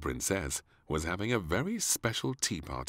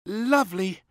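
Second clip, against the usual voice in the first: muttered princess reply; 8 dB louder than usual. Acted. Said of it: "muttered little Princess".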